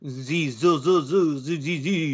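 hammed-up French buzz to mock it; mock French accent. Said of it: "you do a bad French accent".

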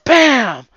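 loud high bam; mimicking the door slamming loudly; door slam sound.

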